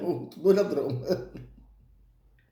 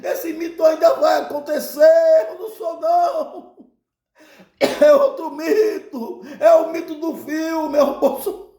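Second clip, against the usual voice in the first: high, raised, comically exasperated protest; Jesus esbravejando; 9 semitones higher, 3 dB louder than usual. Retold or imitated. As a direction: deny it indignantly over a noisy crowd. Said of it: "Jesus começava a esbravejar". (protesto aos gritos, indignado e cômico)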